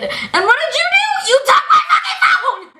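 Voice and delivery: shrieked, very high accusation with laughter in it; outraged accusation. Retold or imitated. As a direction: scream it as if confronting the teacher. Replays outrage at teacher.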